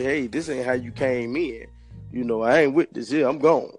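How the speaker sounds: ordinary talk, nothing marked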